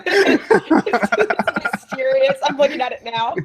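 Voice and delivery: words broken up by high laughter; real laughter while talking; laughing. Real, not acted. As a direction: giggle your way through it. Laughing at the thumbnail.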